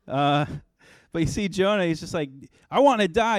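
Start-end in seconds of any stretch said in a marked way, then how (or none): 2.7-3.4 higher, louder whiny wail; a toddler throwing a fit, hammed up; Jonah's tantrum. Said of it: "He's just throwing a fit".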